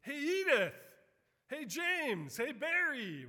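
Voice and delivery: high, loud calls across the street; shouting greetings down the street; yelling out names. Retold or imitated. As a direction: call out to a friend you spot. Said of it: "somebody yells out".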